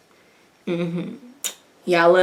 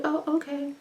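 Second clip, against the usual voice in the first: high, soft, deflated put-on voice; mock resignation; 8 semitones higher, 12 dB quieter than usual. The president caving to Priscilla, soft high resignation. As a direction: give in softly and high. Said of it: "It's like".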